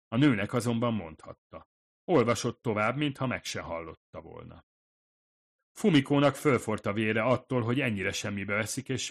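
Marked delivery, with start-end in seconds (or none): none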